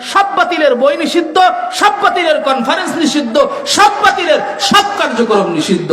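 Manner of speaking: high, forceful oratory with stretched key words; impassioned preaching. Real, not acted. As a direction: thunder it out to the crowd in rising waves. (জোরালো চড়া গলায় ওয়াজ)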